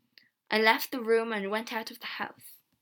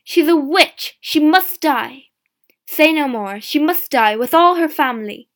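very loud, higher-pitched mob cry; angry shout; 4 semitones higher, 14 dB louder than usual. A narrator's reading. Villagers accuse grandmother of witchcraft, shouting. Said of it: "People began to shout".

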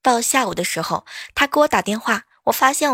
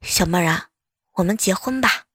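低沉男声=75; 4 semitones lower than usual. Retold or imitated. 男同学借钱.

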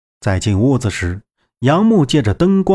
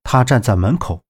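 plain reading voice

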